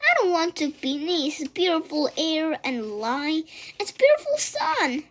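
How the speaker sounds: high, loud fast sobbing plea; tearful wail. A narrator's reading. Wilbur crying to his friends, a high wail. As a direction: wail it through tears.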